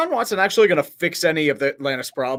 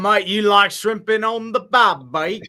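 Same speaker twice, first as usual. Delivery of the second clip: broad mock Australian, higher and drawn out; botched British accent that comes out Australian; hammed-up Australian mate; 6 semitones higher, 4 dB louder, slower than usual. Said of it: "That's Australian".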